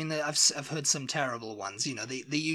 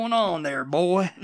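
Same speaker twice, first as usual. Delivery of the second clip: hammed-up American Southern caricature; Deep South accent; 3 semitones higher, 4 dB louder, slower than usual. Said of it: "Deep South".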